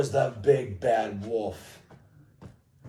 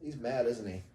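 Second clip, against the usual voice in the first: ordinary delivery, nothing marked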